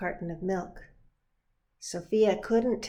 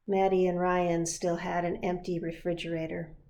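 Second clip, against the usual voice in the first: usual speaking voice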